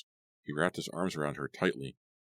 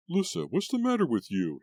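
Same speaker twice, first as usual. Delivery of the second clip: higher, louder alarmed outburst; startled shout; 13 semitones higher, 4 dB louder than usual. A narrator's reading. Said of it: "he shouted".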